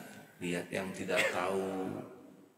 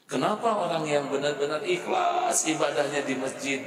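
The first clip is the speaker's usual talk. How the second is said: higher, louder impassioned sermon; fervent preaching; 8 semitones higher, 4 dB louder than usual. Acted. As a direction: preach it out with rising fire. Fiery preaching passage. (ceramah berapi-api, tinggi dan keras)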